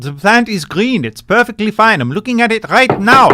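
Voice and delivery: put-on shouting through the door in a thick German accent; German scientist accent. German scientist insisting to Rose the plant is fine, German accent. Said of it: "the German scientists refuse to let her in".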